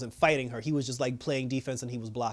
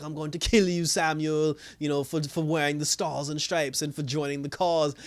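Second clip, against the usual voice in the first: ordinary delivery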